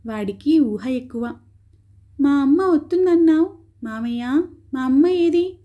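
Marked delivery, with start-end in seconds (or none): 2.2-5.6 put-on higher, insistent little boy voice; lightly voiced boy asking for his mother; child demanding. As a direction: press the uncle like a stubborn child. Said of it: "అని నిలదీసి అడుగుతున్నాడు".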